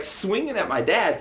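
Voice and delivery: ordinary talk, nothing marked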